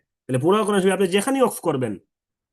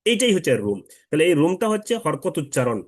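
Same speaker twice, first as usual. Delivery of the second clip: ordinary talk, nothing marked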